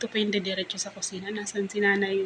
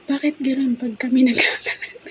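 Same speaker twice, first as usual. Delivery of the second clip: higher, louder grown son complaining, half-mocked; whiny son complaining; 5 semitones higher, 8 dB louder than usual. Grown son complains to his mother about unfairness, mock whiny. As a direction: mimic his aggrieved protest.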